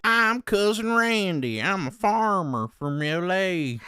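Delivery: high, slow hammed-up country-boy twang; mocking the streamer's corny country character in a slow fake twang; mock country accent. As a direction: play the cornball farmer in a put-on country accent, drawn out. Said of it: "like, country guy accent".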